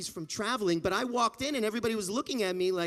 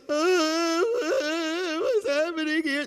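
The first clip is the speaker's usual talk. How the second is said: mock panic; 7 semitones higher, 4 dB louder, slower than usual. People freaking out.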